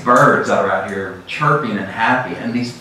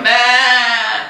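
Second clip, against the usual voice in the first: a high, mock sheep "bah"; playful imitation of a sheep; sheep bleat; 11 semitones higher than usual. Imitating sheep in funny video.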